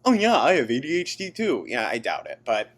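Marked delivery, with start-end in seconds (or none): 0.0-1.7 high, quick dismissive mimicry; sarcastic impression of an online claimant; mock claimant. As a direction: lightly parody a casual self-diagnosis. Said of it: "if someone is like".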